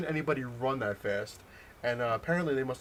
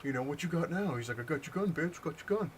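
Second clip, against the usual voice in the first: higher-pitched mocking imitation of a bully; mock taunting; 6 semitones higher than usual. Gunman gloats at storyteller after taking his gun, taunting. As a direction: crow it like a cocky gunman.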